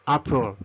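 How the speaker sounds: Apple said slowly as "AH-pol"; Apple as a careful, isolated teaching word; English pronunciation demo. Shown as a demonstration. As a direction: model the word Apple, first vowel open "ah". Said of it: "cuando pronunciamos manzana".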